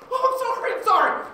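very loud, high-pitched outburst; shrill-high shouted sorry; yelled apology. Acted. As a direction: blurt it out at a high yell. Yelled mock apology in a taunt.

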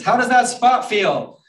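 high, loud, drawn-out call; storybook shout, each word stretched; shouting across distance. Acted. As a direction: holler slowly to someone far away. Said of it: "they have to shout at each other".

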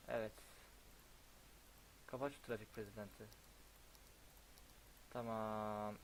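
ordinary talk

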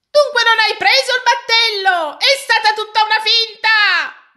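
high, loud accusing cry; indignant shout. A narrator's reading. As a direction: shout at the runaway nose. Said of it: "gridò quel signore". (grido indignato, acuto e forte)

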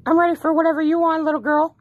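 high, fast creepy troll voice; internet troll. Pretend. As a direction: creep them out with a silly high voice. Trolling strangers in voice chat.

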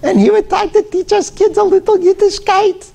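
put-on high-pitched Russian-accented old teacher; high Russian-accented voice of the old Hebrew teacher; old-country Russian accent. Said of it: "very high, little thick accent like this".

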